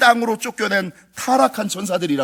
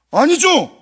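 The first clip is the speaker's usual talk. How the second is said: emphatic denial; 10 semitones higher, 8 dB louder, much slower than usual. Real, not acted. Preacher's emphatic rebuttal.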